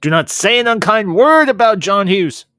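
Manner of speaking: loud, high scolding shout; mock outrage. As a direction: thunder it like an indignant fan defending an idol. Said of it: "my blood pressure's going through the roof".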